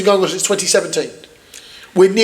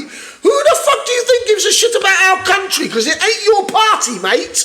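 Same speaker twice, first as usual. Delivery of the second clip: much higher, laughing as you shout; angry laughing rant; 11 semitones higher than usual. Real, not acted. Accusing the Tory party of not caring, laughing angry shout. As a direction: scoff in outrage.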